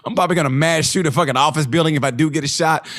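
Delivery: ordinary talk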